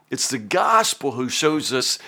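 ordinary talk, nothing marked

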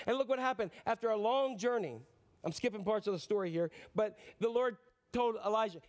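high-energy dramatic sermon narration; animated preaching. Real, not acted. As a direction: preach the storm building, pitched high and forceful.

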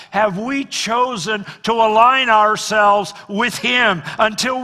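loud, high, driving sermon; preaching at full force. Acted. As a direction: hammer every point home to the church.

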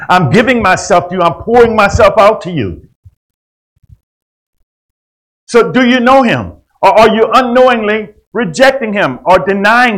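loud, high, driving preacher's cry; fervent pulpit preaching, loud and high; preaching at full force. Acted.

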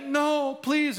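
very high, wailing please; desperate begging. Retold or imitated. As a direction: cry out for mercy, voice way up. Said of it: "And he begs the king".

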